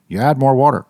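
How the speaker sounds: ordinary talk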